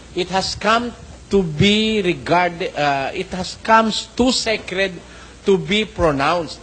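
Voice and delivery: slow, deliberate quote reading; careful recitation of a printed note; slow reading aloud. Acted. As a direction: spell out each word of the passage. Rereads the quote slowly.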